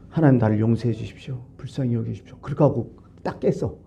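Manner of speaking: normal talking voice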